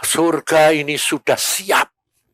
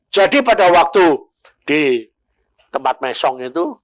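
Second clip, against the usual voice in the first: normal talking voice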